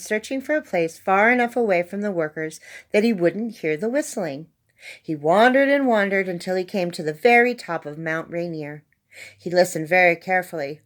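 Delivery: ordinary delivery, nothing marked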